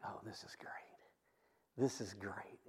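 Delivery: hushed, excited whisper; breathless whisper of a hungry teen; whispered delight. Retold or imitated. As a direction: whisper it like a secret treat. Smelling hamburger, kid delighted.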